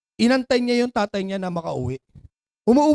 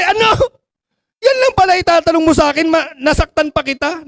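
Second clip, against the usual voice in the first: much higher, louder astonished outburst; shocked disbelief; 7 semitones higher, 9 dB louder than usual. Acted. Father stunned at the small question, loud disbelief. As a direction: burst out in disbelief. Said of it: "Nagulat yung tatay".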